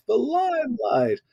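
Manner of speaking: high, slow and starry-eyed; dreamy grandeur. Retold or imitated. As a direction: savor it like a dream of fame. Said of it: "I thought".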